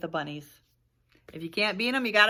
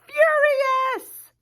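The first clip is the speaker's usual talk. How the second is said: furious, much higher and louder; big word; 11 dB louder than usual. Shown as a demonstration. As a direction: shout out furious as a huge word. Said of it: "look at the size of that word".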